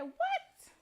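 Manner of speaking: high, loud shocked gasp of a question; shocked disbelief. Retold or imitated. Mother retelling the other parent's shocked reaction, high and loud. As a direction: act out the other mom's stunned outrage. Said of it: "And she said".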